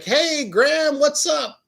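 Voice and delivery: high, loud-ish happy hello; cheery greeting. Retold or imitated. Grandson picks up grandma's surprise call, cheery high greeting. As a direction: greet her high and breezy.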